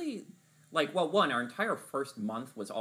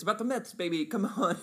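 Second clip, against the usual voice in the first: higher, hyped-up Mets fan; sports fan meme; 9 semitones higher, 5 dB louder than usual. Retold or imitated. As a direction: cheer like a pumped-up fan. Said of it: "We sound like the meme of".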